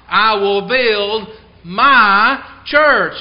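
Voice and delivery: MY set apart, slow and loud; emphatic stress on MY. Real, not acted. Preacher quoting Christ's promise to the congregation, emphatic on MY. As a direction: draw out MY in "I will build my church".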